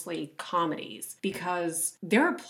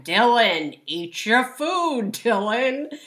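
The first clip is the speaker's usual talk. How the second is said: a touch higher, slow, repeating the same nag; nagging mom; 5 semitones higher, 5 dB louder, slower than usual. Retold or imitated. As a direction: mimic the mom's tired refrain once more. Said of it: "she says it again".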